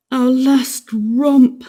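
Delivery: slow, high mournful groan. Acted. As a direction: lament it with a groan. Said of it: "Mister Darling groaned".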